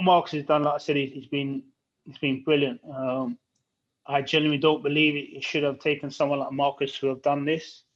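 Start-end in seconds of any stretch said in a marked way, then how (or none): none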